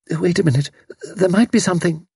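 high-pitched tentative murmur. A narrator's reading. Mother stops Major calling police, murmuring hesitantly. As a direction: murmur a sudden thought in a high voice. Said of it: "Missus Sprott murmured".

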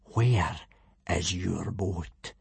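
slow, hushed menace; ominous calm before the storm; dangerously calm. A narrator's reading. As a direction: ask it softly and very deliberately. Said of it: "his voice dangerously calm".

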